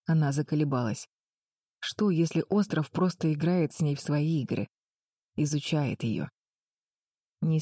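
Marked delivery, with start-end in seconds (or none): none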